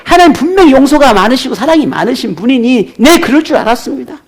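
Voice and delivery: high-pitched resentful accusation; Jonah's bitter complaint. Retold or imitated. 요나가 자비로운 하나님을 원망하며, 높은 목소리로. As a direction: throw God's mercy back at Him. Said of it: "요나는 마음에 있는 이 분노를 쉽게 잠재울 수가 없습니다".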